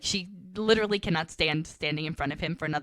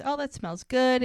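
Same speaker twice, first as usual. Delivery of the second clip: higher, lightly acted delight; appreciative; 7 semitones higher, 3 dB louder than usual. Guest at Tara's dinner, lightly delighted. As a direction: coo a compliment over dinner, retold.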